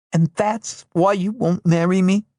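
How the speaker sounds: hurt fury flaring up; suddenly furious. Acted. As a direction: lash out at him. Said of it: "suddenly furious".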